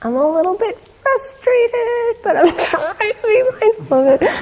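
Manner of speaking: put-on teacher repeats the boy's line, laughing fondly; laughing retelling. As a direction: retell his words while cracking up with delight. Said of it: "It was so great".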